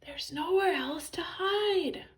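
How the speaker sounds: high, airy stage whisper; high-pitched anxious hush. A narrator's reading. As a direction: whisper it urgently while hiding. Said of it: "whispered George".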